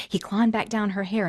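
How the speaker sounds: ordinary delivery, nothing marked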